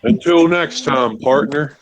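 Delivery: mock western sign-off in a cowboy accent; cowboy farewell. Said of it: "That was a Red Dead Two cutscene".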